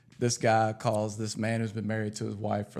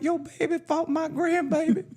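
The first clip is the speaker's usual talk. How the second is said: high, slow comic grandma; hammed-up old lady complaining, amused; slower than usual. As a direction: imitate a riled grandmother, pitched way up.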